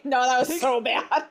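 ordinary delivery

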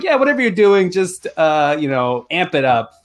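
imitated director. Director telling actors to overdo it, mock encouraging. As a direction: toss out an offhand direction, hammed up. Acting out a director's careless notes.

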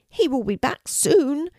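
put-on high childlike dragon voice; little dragon. A narrator's reading. Norman tells Kelty about daddy, little dragon voice. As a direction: answer high, like a small creature. Young dragon answers girl.

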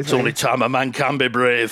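low-pitched mock-heroic actor impression; Sean Bean. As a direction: lightly imitate a solemn Yorkshire actor. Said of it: "Oh, that's Sean Bean".

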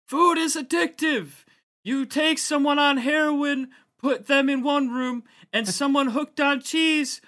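loud, high raspy mayor voice; Eric Adams impression. [name] doing the mayor for his co-hosts. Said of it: "he kind of be like".

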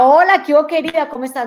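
hammed-up happy greeting to a friend; mock cheery greeting. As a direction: play a bubbly woman breezing in. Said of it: "Tú no te imaginas a alguien que llega".

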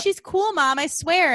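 high-pitched mock plea to Mom; pleading kid. Voicing child reassuring a wary parent.

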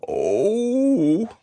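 high, long straining moan; drawn-out cry of strain; rising bear groan; pained "oh". A narrator's reading. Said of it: "Pooh only said".

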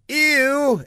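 high, sharp cry of disgust; disgusted exclamation. A narrator's reading. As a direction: recoil with a loud "ew". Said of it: "exclaimed Marcia".

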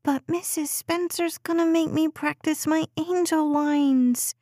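put-on high little girl complaining; whiny child. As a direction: whine like a reluctant kid.

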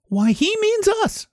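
surprised little fruit voices; startled cherries. Acted. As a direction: squeal it like frightened little cherries. Cherries cry out in alarm.

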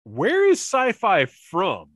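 a bewildered double take at the voice; surprised. Retold or imitated. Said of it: "I was like".

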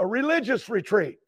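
ordinary talk, nothing marked

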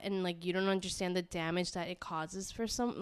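usual speaking voice

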